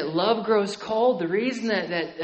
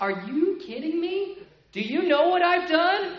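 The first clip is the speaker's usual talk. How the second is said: astonished disbelief; 9 semitones higher than usual. Retold or imitated. Amazed new believer.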